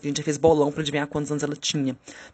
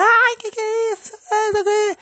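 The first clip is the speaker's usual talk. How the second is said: imitated screaming; 5 dB louder than usual. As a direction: mimic a panicked scream from the stall. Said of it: "ela começou a gritar". (gritaria imitada, bem aguda)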